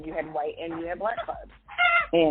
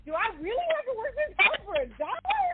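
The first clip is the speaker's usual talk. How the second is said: laughing complaint; 12 semitones higher than usual. Retold or imitated. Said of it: "I'd be like".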